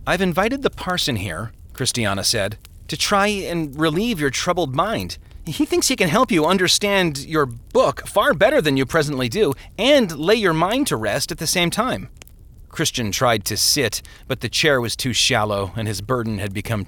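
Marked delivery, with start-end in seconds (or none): 0.1-1.5 wife explaining. A narrator's reading. Wife explains the parson's visit. 2.9-12.1 higher-pitched wife's voice; earnest wife. A narrator's reading. Wife explains the parson's visit to her troubled husband, earnest.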